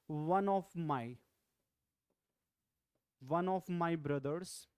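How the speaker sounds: slow, careful dictation pace; slow model sentence. Shown as a demonstration. Teacher models correct sentence for class, slowly. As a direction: spell it out slowly for learners. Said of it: "You can speak like".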